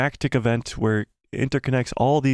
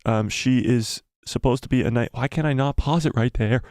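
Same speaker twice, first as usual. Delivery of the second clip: ordinary delivery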